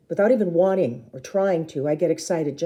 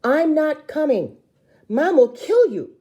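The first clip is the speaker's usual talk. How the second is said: loud, high, slow yell; yelling after her; 11 semitones higher, 8 dB louder than usual. A narrator's reading. Big sister yelling after runaway sister, loud and slow. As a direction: shout it down the hall. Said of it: "yell after her".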